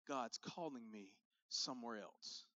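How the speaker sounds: drawn-out holy excuse, lightly mocked; a hint of a self-righteous church hopper; mock pious excuse. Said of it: "they say this phrase".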